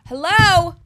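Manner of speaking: high, loud, thrilled call out; loud giddy hello; excited shout. Pretend. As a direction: call out like greeting the whole world. Dreaming of worldwide fame.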